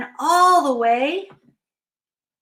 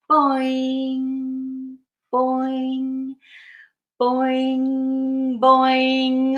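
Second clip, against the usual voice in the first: springy monotone boing with ringing end; trampoline boings; much slower than usual. Acted. Bear jumps on the trampoline, springy boings. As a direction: bounce each word and hold the hum.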